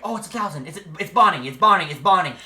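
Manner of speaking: hammed-up eager friend insisting; mock Terry. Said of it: "he was like".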